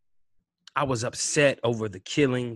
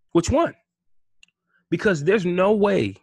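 loud challenge; 6 semitones higher, 6 dB louder than usual. Real, not acted. Ranting at rioters.

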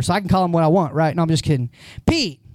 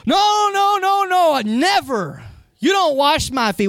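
Peter protesting; 8 semitones higher, slower than usual. Retold or imitated. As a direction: playfully protest like Peter, pitch jumping up. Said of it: "Remember Pete's response?".

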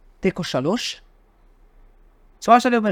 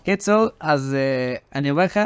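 ordinary talk

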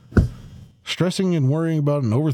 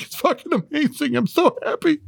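mock teary joy; 12 semitones higher than usual. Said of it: "and I was like".

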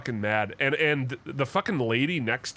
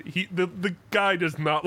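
normal talking voice

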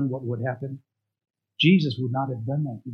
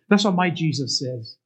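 usual speaking voice

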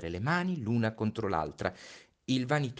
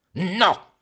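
sharp loud cry of refusal; king's sudden loud no; shouted no; 12 semitones higher, 12 dB louder than usual. Acted. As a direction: stop him with a loud no. King cries out as prince leaves.

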